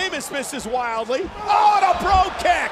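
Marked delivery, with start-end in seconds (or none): 1.4-2.7 loud, higher, full-throated call of the move; excited call. Real, not acted. Commentator roars as the Bro Kick connects. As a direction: let the crowd feel the impact.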